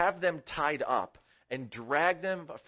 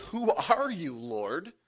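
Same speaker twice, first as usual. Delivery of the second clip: scared Saul; 5 semitones higher, 4 dB louder, slower than usual. Acted. Saul frightened by heavenly voice.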